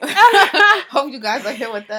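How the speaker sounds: teasing echo, cracking up; laughing mock repeat. Mocking cohost's corny line.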